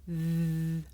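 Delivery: Th. with tongue tip between the teeth; th sound. Shown as a demonstration. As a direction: show Th. as a slow held buzz.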